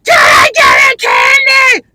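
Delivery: put-on high shrill toddler scream, slow and loud. Toddler screaming for candy at mom. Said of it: "And then they go".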